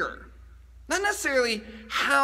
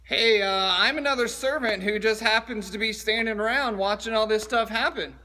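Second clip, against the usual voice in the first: ordinary delivery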